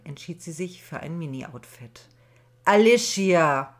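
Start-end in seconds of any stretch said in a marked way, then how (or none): 2.6-3.7 slow, much louder drawn-out reproachful call; mother calls again, loud and reproachful; reproachful booming call. Acted. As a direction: boom her name up the stairs in reproach. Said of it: "Diesmal klang es vorwurfsvoll".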